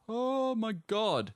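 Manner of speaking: breathy, slow, high awe; awed gasp. Retold or imitated. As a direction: stretch it out in wonder. Awed reaction quote.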